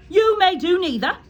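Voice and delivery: loud, high sharp snap; snapped. Acted. Trunchbull refuses Nigel's request, loud sharp snap. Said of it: "snapped the Trunchbull".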